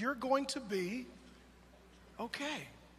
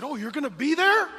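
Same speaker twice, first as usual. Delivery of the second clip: put-on delighted child; 5 semitones higher, 10 dB louder than usual. Toddler brightens.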